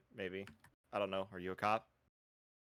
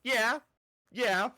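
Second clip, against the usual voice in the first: excited; 11 semitones higher, 6 dB louder than usual. Real, not acted. Excited loud yeah.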